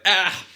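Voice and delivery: loud mock yelp of fright; mock startled yelp; startled cry. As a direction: yelp as if recoiling. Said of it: "you're like".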